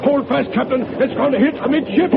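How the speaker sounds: high, urgent warning; alarmed. Acted. First mate warning the captain before impact, high urgent cry. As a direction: brace for the hit, voice tight with alarm. Torpedo about to strike.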